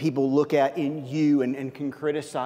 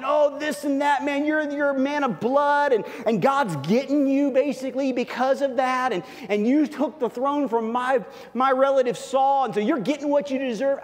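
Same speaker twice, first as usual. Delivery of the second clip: shouting curses up high, ranting; mock angry shouting; 8 semitones higher than usual. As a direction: hurl the accusations like an enraged man on the road. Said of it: "he's shouting it".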